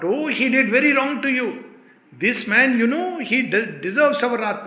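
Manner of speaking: high, loud outraged protest; mock indignation. Teacher voicing a disciple's grievance, indignant. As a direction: protest indignantly on someone's behalf. Voices an indignant follower's reaction.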